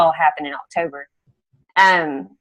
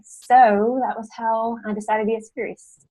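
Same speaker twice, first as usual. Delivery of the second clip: shaky sigh; 5 semitones higher than usual. Real, not acted. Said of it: "That makes me cry".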